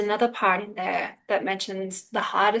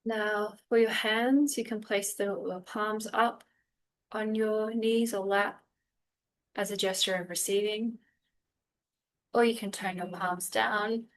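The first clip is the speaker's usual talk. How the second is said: unhurried meditation guide with long pauses; calm, spacious guiding voice; guided meditation; 4 dB quieter than usual. Real, not acted. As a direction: ease them gently through breath and body, phrase by phrase.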